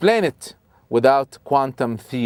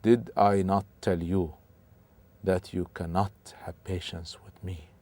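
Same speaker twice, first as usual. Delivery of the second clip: softer, quiet and unhurried reply; very calm; 5 semitones lower, 9 dB quieter than usual. Acted. Al-Khidr calmly reminding Musa of the condition, soft. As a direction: answer with calm patience. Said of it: "Al-Khidr very calmly said".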